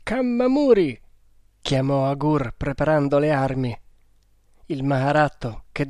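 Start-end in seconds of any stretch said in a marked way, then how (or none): 0.0-1.0 loud high call across the camp. A narrator's reading. Agur calls Kammamuri awake, loud urgent call.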